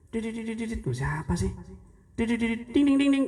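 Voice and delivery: high sung phone ringing noises; ringtone imitation. Preacher mimicking a phone ringing during prayer.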